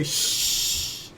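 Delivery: whispered shh; shushing. Retold or imitated. Guy demonstrating how to shush the assassin, whispered. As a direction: hush them with a whisper.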